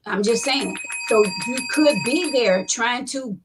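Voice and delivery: normal talking voice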